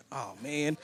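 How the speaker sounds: ordinary talk, nothing marked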